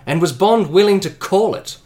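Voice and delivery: steady game master narration of Bond's doubts; story narration. A narrator's reading. Game master narrating Bond's doubts to the players, measured. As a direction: read it like a thriller narrator.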